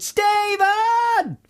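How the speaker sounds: long high hollered name; shouted. Retold or imitated. Listener retelling shouting in the planetarium, a high holler. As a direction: call across the museum. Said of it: "I shouted".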